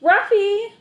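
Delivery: high, loud call; boy calling his lost dog. A narrator's reading. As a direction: shout for the dog.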